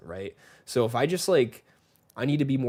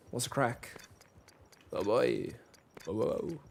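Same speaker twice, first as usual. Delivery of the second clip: lightly imitated Irish accent, softer; 5 dB quieter than usual. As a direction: echo Irish friends with a slight Irish accent. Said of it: "But they're Irish".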